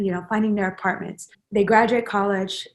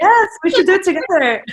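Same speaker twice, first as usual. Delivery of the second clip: high, loud burst of excited agreement that breaks into laughter; excited laughing agreement; 10 semitones higher, 9 dB louder than usual. Real, not acted. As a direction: jump in delighted and laugh it out.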